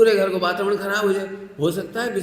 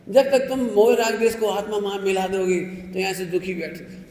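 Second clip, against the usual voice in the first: ordinary talk, nothing marked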